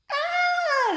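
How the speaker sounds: very high, loud frightened shriek; scream. A narrator's reading. Twins screaming in fright at Ned's boo, high shriek. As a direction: shriek as if jumped out at. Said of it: "the twins screamed".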